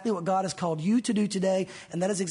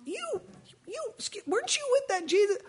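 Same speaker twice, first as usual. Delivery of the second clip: put-on much higher, small girl's voice; little girl voice. Servant girl questioning Peter in a much higher voice. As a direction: pipe up like a curious little girl. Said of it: "a little girl saying".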